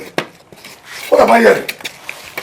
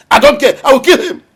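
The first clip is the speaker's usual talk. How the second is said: much higher, louder raging cry; furious shout; 8 semitones higher, 5 dB louder than usual. Acted. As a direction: scream it in blind fury. Enraged father escalates.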